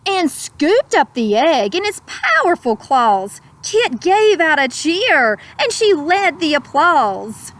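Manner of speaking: put-on slow, lilting read-aloud to children; gentle rhyming storyteller; storybook narration. A narrator's reading. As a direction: savour each word like a bedtime story. Rhyming picture-book narration.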